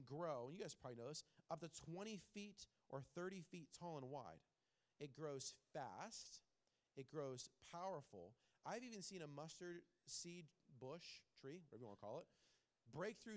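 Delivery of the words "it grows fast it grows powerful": slow emphatic. Acted.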